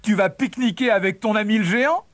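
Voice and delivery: high, mocking tease; jeering. A narrator's reading. Brothers making fun of the cheese, jeering. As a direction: tease him, rattling off the last question. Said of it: "Ses frères éclatèrent de rire".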